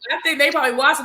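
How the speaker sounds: ordinary talk, nothing marked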